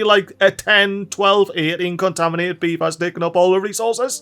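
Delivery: ordinary talk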